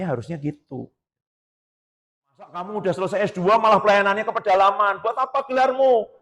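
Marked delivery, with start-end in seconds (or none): 2.2-6.1 mocking critic. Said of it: "Nanti kan ditanya itu".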